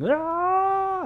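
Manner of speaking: high mock wailing cry; imitated mourners' wail. Preacher imitating paid mourners at a funeral, high mock wail. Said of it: "that's how the way they would yell".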